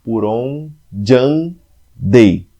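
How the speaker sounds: "por onde andei" stretched out, very slow; syllables scanned slowly. Shown as a demonstration. Teacher counting the four syllables for the class, very slowly. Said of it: "primeiro verso com quatro sílabas".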